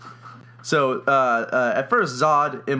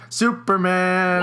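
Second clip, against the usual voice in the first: drawn-out, raised announcer cry; grand hero announcement; 6 semitones higher, 3 dB louder, slower than usual. Acted. Narrator reveals Superman to the audience, grand announcement. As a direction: proclaim the name big and long. Announces the hero's alter ego.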